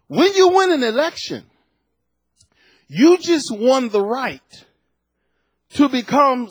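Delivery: fervent sermon climb; preaching at full force. Acted. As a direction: rouse the congregation, voice lifted high. Preaching on winning elections.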